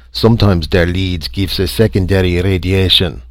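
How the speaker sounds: put-on loud, thick German accent; German-accented engineer. A narrator's reading. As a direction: play a German engineer offering technical advice. German engineer character gives technical explanation.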